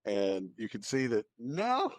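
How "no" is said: ordinary talk, nothing marked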